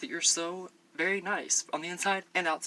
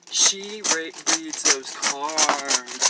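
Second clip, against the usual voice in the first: very slow, booming emphasis; loud drawn-out hype; 9 dB louder, much slower than usual. Real, not acted. Vlogger hyping a fellow YouTuber's tarot readings, loud and drawn out. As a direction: stretch each word out loudly.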